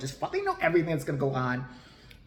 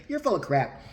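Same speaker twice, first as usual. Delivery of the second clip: usual speaking voice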